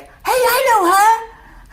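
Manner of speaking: slow, high, loud New Jersey call; New Jersey accent. Retold or imitated. A New Jersey neighbor recognizing her in line, loud and drawn out. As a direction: stretch it out like a Jersey neighbor spotting you. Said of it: "in a New Jersey accent was like".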